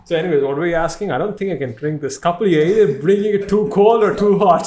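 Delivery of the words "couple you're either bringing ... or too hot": raised, half-laughing gripe; mock complaint. Real, not acted. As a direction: scold the helper playfully. Complaining loudly about the water.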